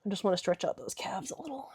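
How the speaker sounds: breathy whispered aside. Real, not acted. Stretching in the pose, whispering to herself. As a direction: whisper it to yourself mid-stretch.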